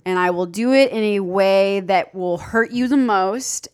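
usual speaking voice

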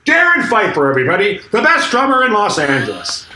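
high, hammed-up sarcastic acclaim; mocking proclamation. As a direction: imitate a man mockingly announcing a star. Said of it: "threw his hands up in the air".

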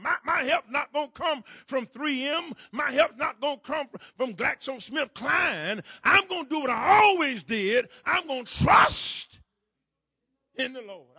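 shouted, rising sermon cadence; full-force preaching. Acted. Preacher rallying his congregation, preaching at full force. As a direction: preach it hard to the pews.

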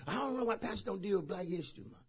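lightly voiced complaint, soft and quick; hushed, hurried mock complaint; grumbling church member. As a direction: quietly imitate a congregant's grumble. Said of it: "I know some of you say".

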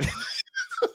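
very high burst of laughter; sudden laughing shriek. Real, not acted. Co-host bursting out laughing mid-sentence, high squeal.

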